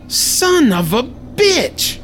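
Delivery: high, very slow, drawn out; shocked curse. Acted. Hero staring at the frog, drawn-out shock. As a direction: stretch the curse out in disbelief. Sees doctor become frog.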